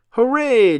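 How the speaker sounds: high, loud hooray; cheering. A narrator's reading. Peppa sees the muddy puddles, loud high cheer. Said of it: "cheered Peppa".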